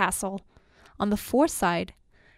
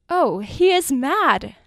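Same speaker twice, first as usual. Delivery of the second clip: slow, loud, raised wail of horror; narrated scream; 4 semitones higher, 6 dB louder, slower than usual. A narrator's reading. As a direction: cry out in horror, short of a full scream. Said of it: "she screamed".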